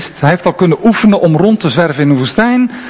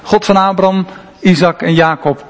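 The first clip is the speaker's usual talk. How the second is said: ordinary talk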